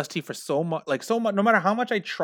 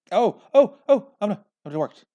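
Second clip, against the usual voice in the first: surprised relief; 8 semitones higher, 7 dB louder than usual. Real, not acted. Relief when recording works.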